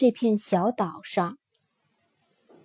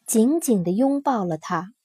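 usual speaking voice

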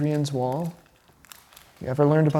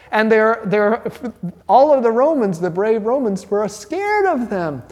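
excited storytelling; 6 semitones higher than usual. Real, not acted. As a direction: sell how scared the Romans were. Telling of terrified Romans.

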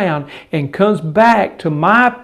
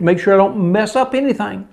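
usual speaking voice

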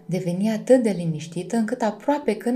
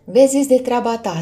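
loud, higher-pitched snap; irritated retort; 4 semitones higher, 8 dB louder than usual. A narrator's reading. Luna brushes off Firion's questions, irritated. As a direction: snap it back to shut someone up.

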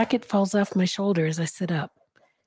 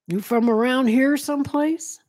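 put-on higher, louder Southern-accented question; 6 semitones higher, 5 dB louder than usual. A narrator's reading. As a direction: probe like a lightly Southern-accented officer. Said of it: "officer with a Southern accent".

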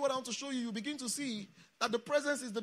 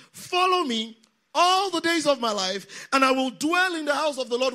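loud declamation; 4 semitones higher, 10 dB louder than usual. Real, not acted. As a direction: declaim it so the congregation fills in. Declaims psalm for congregation to complete.

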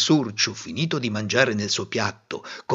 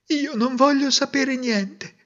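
put-on young princess; 13 semitones higher than usual. A narrator's reading. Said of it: "disse la reginella".

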